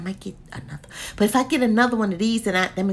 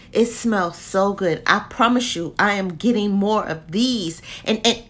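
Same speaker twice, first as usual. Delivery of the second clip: normal talking voice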